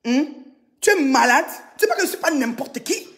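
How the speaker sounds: ordinary delivery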